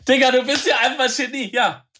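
high, loud amazed praise; in German: verblüfft und begeistert. Real, not acted. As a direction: blown away that he guessed it. Friend guesses the song.

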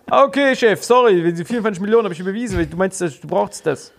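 rushing to explain; flustered employee. Retold or imitated. Employee answers boss in scene.

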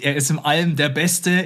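ordinary talk, nothing marked

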